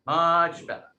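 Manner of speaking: loud, high Rhode Island stretch on "Much"; Rhode Island accent. Shown as a demonstration. Promising the class they will speak much better, Rhode Island accent. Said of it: "understand the Rhode Island accent".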